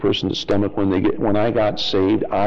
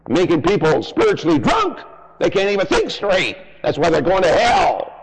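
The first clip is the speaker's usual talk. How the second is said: preaching at full force; 9 semitones higher than usual. Acted. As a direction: thunder it from the pulpit. Fiery sermon on religion.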